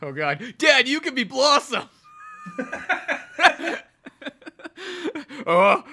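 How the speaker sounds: ordinary delivery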